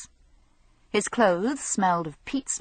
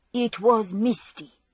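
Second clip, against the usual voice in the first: plain reading voice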